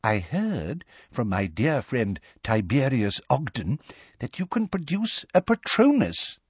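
put-on confiding, high elderly quaver; quavery old man. A narrator's reading. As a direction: coax in a wavering old voice, softly. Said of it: "in his quavery old voice".

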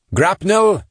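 high loud barked order; commanding shout. A narrator's reading. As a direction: call it out hard. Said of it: "he commanded".

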